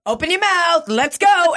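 spunky, high-pitched rallying calls; high, pushy hollering; Jerome. Retold or imitated. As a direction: imitate a coworker herding people along. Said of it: "in a particularly spunky mood".

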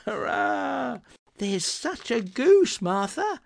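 put-on very high, slow, loud child cheer; excited children. A narrator's reading. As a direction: cheer like kids who smelt the goose. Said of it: "cried the two young Cratchits".

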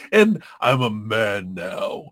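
low, slow, puffed-up man voice; newly manly nerd. Retold or imitated. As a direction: drop the pitch to boast of manhood. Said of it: "he's like".

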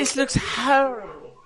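mock Southern accent. Mocking the host's Alabama accent, slow Southern. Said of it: "don't make fun of my accent".

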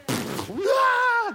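put-on high, loud panicked child yell; panicked scream. Child thrown in deep end.

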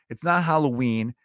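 normal talking voice

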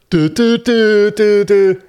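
high, loud repeated "do" syllables; sing-song stalling noise. Real, not acted. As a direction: turn a stuck word into a drawn-out high toot. Tongue-tied stammer turned into noise.

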